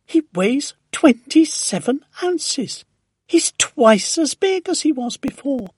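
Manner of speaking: high, loud, thrilled announcement; excited shouting. A narrator's reading. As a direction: shout amazing news up to a neighbour. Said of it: "and shouting".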